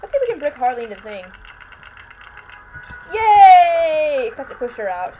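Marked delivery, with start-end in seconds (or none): 3.1-4.3 loud high yay; cheering. Real, not acted. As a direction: burst out in delight. Happy cheer.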